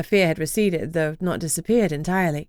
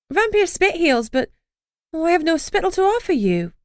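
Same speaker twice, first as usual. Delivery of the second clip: regretful; 10 semitones higher than usual. A narrator's reading. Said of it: "she said regretfully".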